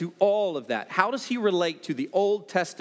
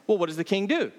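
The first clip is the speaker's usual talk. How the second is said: usual speaking voice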